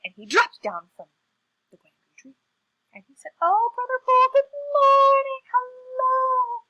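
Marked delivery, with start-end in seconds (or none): 3.4-6.7 very high, loud, drawn-out hello; gushing greeting. Acted. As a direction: sing out an over-sweet welcome. Trickster gushes greeting at Bull.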